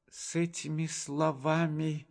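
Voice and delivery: plain reading voice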